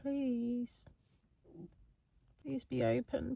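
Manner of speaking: hushed, begging whisper; whispered pleading. Real, not acted. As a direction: whisper a desperate please. Pleading at the closed shop.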